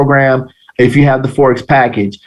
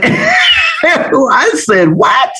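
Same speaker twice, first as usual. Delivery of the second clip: high-pitched laughing outburst; laughing disbelief; 10 semitones higher, much slower than usual. Retold or imitated. Student amazed at how simple flags are, laughing.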